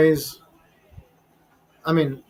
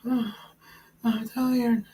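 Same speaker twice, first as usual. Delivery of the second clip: mock tired sigh; 4 semitones higher, 5 dB quieter than usual. Mocking woman's tired complaint.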